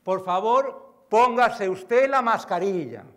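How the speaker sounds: slow, loud, deliberate safety announcement; flight attendant announcement. Retold or imitated. Speaker imitating the in-flight safety message to the audience, slow and loud. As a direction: spell it out like cabin crew over the speaker.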